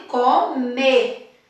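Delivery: comer with a punched final MER; teacher showing the strong MER in comer; stress on MER in comer. Shown as a demonstration. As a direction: hit the last syllable of comer. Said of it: "que eu falo com mais intensidade".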